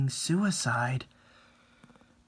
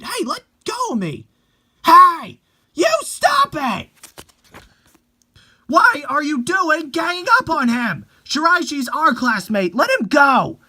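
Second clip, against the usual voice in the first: high, loud protest; desperate shout; 10 dB louder than usual. Acted. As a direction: shout to stop the fight. Girl defends classmate from mob.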